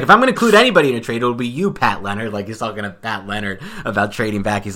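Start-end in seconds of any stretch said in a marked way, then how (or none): 0.0-2.3 loud, high, hurried mock Boston accent; crappy Dave Gettleman accent. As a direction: belt out a badly faked Boston accent. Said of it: "I'll do my crappy Dave Gettleman accent".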